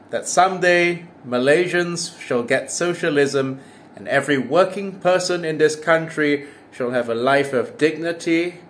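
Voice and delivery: normal talking voice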